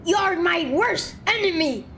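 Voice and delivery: playful high war cry; mock battle challenge. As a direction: declare it like a game hero charging. Challenges enemy in game fight.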